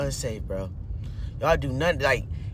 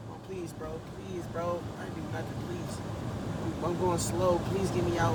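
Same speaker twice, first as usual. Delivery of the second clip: much softer, desperate begging; hushed pleading; 12 dB quieter than usual. Real, not acted. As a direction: plead under your breath. Begging while car slides.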